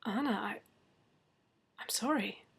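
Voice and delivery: barely voiced apology; choked whisper. A narrator's reading. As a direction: force the words out in a whisper. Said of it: "I managed at last".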